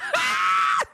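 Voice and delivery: very high squealing laugh; real high-pitched laugh. Real, not acted. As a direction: shriek with laughter. Streamer laughing at clip.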